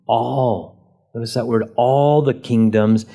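"all" held long and set off by a pause; stress on all. Shown as a demonstration. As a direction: stretch "all" each time it comes. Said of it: "on all".

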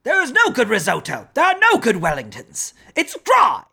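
high, ranting celebrity chef; Gordon Ramsay. Acted. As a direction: scold like a furious TV chef. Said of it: "do you want me to do a Gordon Ramsay for you".

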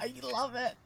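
high, soft squeal of delight; delighted gushing. Real, not acted. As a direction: gush in a small high voice. Delighted by the quote just read.

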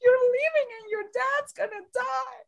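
high, teary half-laughing wail; laugh-crying. Retold or imitated. Cohost recalling her reaction, teary laugh-cry. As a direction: laugh through fake tears. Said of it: "I was like".

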